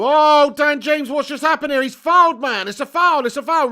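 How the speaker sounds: sudden loud, high burst at a foul; excited outburst. Real, not acted. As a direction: jump up yelling at the screen. Live football foul outrage.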